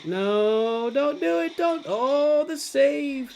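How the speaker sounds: high, drawn-out cry of real alarm; frantic pleading. Real, not acted. Gamer begging the play not to fail, frantic. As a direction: plead at the screen, pitch soaring. Frantic reaction to a near loss in game.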